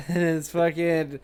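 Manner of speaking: usual speaking voice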